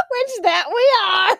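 giggling through it; laughing. Real, not acted. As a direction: squeal it while laughing. Laughing admission.

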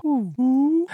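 high creature coo; owlbear cooing. Acted. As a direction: coo like a big owl bird. Owl-bear creature sound.

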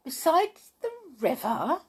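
high, rising gasp of disbelief; astonished. Acted. Tiptoe hears Jolly was seen by the river, astonished. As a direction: Tiptoe can't believe her friend went so far. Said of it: "said Tiptoe in astonishment".

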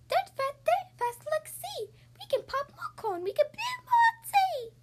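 very high soothing squeak; a tiny mouse hurrying to calm the bear; squeaky mouse. Acted. Mouse comforting the upset bear, very high squeaky voice. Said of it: "Mouse squeaks".

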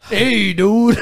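loud, high-swinging mock dude greeting; stoner dude impression. Friend riffing on the Jeff Bridges pick, hammed-up dude voice. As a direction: play the laid-back movie dude, hammed up.

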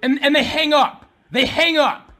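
very loud, high-pitched outrage; outraged shout. Real, not acted. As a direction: yell it in disbelief at the injustice. Enraged at being hung up on.

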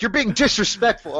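hammed-up teacher telling off a student; mock stern teacher. Teacher scolding the laughing student, high mock stern.

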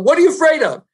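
sudden high-pitched shouted question; retold shout. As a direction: shout it as a challenge, pitch jumping up. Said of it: "So I yell".

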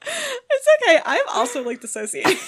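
laughing. Real, not acted. Laughing through words.

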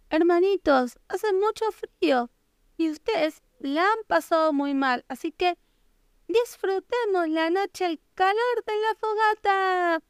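high, kind piglet voice; big sister pig voice. A narrator's reading. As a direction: comfort them in a high sweet voice. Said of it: "dijo la cerdita mayor". (cerdita mayor, voz aguda y tierna)

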